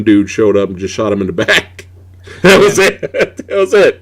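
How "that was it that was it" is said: laughing through the words. Real, not acted. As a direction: chuckle out the punchline. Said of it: "what made me laugh".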